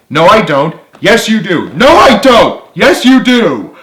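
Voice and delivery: building from loud to very loud and high; escalating bickering. A narrator's reading. Childish back-and-forth quarrel.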